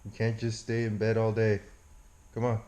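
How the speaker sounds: hushed, sleepy coaxing to the dog; soft talk to dog. Real, not acted. Owner coaxing his dog out of bed, soft and hushed. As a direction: murmur gently to wake him.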